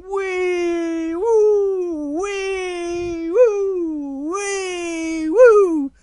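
very high, long wee-woo swoops; gleeful swinging whoops. Acted. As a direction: whoop back and forth as if swinging. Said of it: "So the polar bear's going".

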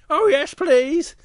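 high, loud, drawn-out acceptance; eager little bear. Acted.

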